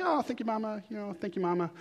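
soft, dutiful thank you; polite child thanks. Retold or imitated. Child thanking mom for small gifts, soft and dutiful. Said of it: "you're like".

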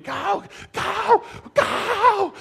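high-pitched cheering yell at the game; retold scream. Parent cheering a home-run ball over the fence, screaming. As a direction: re-enact an excited "go" yell, drawn out. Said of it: "I stood up screaming".

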